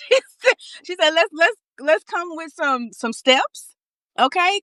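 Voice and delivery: high, bright-pitched imitation of the therapist urging her on; voicing her therapist. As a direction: lift way up and play the therapist saying go for it.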